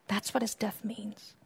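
unvoiced, intimate whisper; hushed whisper of awe. Real, not acted. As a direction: breathe it to the congregation. Sermon whispered aside.

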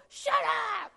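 high, hammed-up yell; mock temper scream. What a president or king does not go, mock screamed. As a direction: screech it like a king with no authority. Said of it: "does not go".

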